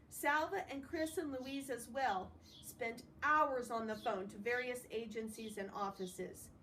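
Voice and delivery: plain reading voice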